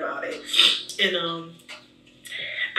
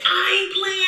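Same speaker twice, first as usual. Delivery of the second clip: high, louder retelling of her own protest; mock insistent retort; 11 semitones higher, 4 dB louder than usual. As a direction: squeak it out, half-laughing, insisting. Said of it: "I said".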